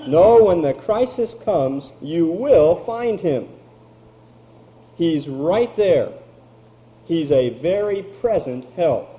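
ordinary delivery

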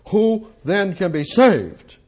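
high, slow, astonished cry; amazed disciples. A narrator's reading. Disciples asking Jesus who can be saved, amazed. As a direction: disciples gasping out their shock. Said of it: "they were exceedingly amazed".

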